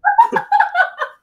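high laugh. Real, not acted. Laughing at the comparison.

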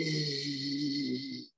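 low, soft sustained zzz; snoozing buzz. Pretend. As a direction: buzz like someone fast asleep. Sleepers snoozing in picture book.

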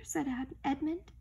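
plain reading voice

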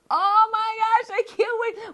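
eager fan hearing the news; can't-wait excitement. Real, not acted. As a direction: stretch the oh with eager delight. News of new dolls.

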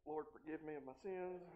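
low, very soft confession; mock sheepish prayer. Preacher praying for fishing luck, hushed mock contrition. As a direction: pray it under your breath, playfully.

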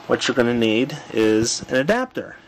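ordinary talk, nothing marked

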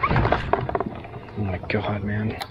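whispered out of breath; breathless whisper. Real, not acted. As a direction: gasp it out under your breath. Exhausted by the fight.

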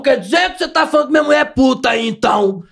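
confronting husband. Retold or imitated. Acts out an angry husband's confrontation.

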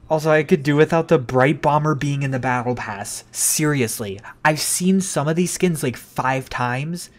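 normal talking voice

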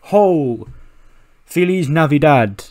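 loud ho then slow Feliz Navidad; loud festive call; jolly shout. Acted. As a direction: belt out the greeting. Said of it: "He's like".

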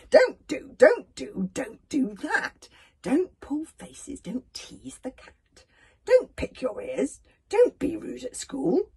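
high, loud parent listing rules; nagging parent. Acted. Parent reeling off rules to the child: high, loud nagging. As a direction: scold like a fed-up parent. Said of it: "this is the parent talking to the child".

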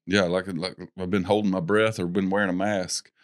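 usual speaking voice

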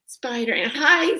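ordinary talk, nothing marked

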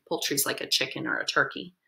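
ordinary delivery

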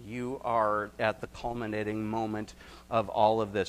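ordinary delivery

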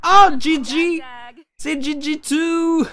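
loud, high squeal of delight; thrilled squeal at spotting Gigi; excited. Real, not acted. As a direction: shriek her name in delight.

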